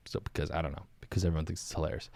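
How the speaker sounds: normal talking voice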